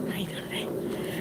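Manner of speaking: breathy whisper to herself; whispered inner reply. Retold or imitated. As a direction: whisper it back to yourself, slowly.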